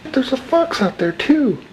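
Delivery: high, amazed exclamations; startled and amazed at the sight; surprised. Real, not acted. Spots orb and fox outside.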